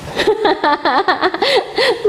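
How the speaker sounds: a high, amused laugh. Real, not acted. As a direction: laugh fondly at the silly answer. Mother amused by child's answer.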